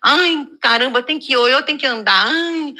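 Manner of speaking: hammed-up reluctant whine; mock complaining. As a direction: imitate someone dragging themselves out to walk. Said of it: "Quando você vai reclamando".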